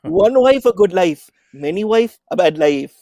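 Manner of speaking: put-on loud, high Indian accent; father's Desi accent, loud and emphatic. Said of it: "I'm gonna use his accent".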